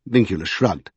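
usual speaking voice

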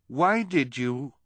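slow, high, loud question wavering; half-firm. Acted. Violet demanding answers, not quite firmly. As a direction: try to sound firm and fall short. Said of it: "not as firmly as she would have liked".

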